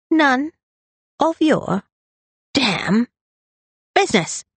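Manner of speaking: high, word by word with pauses; irritated, staccato repetition; emphatic rebuff. A narrator's reading. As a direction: punch out each word separately. Brother repeats rebuff word by word.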